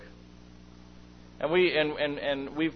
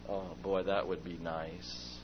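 wistful sigh; 10 semitones lower, 13 dB quieter, slower than usual. Retold or imitated. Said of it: "I'm going".

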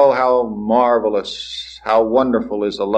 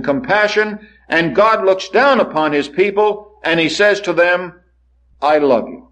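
rising and falling sermon cadence, building in waves; preaching; 4 semitones higher than usual. Acted. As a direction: preach it to the congregation with conviction. Preacher delivers sermon to congregation.

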